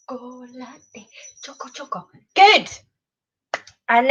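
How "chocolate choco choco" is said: much softer murmur; soft demo. Shown as a demonstration. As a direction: murmur it under the breath with the action. Softly voicing the action.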